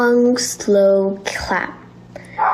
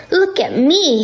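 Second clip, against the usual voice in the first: calling out; 7 semitones higher than usual. A narrator's reading. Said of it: "he called from high above".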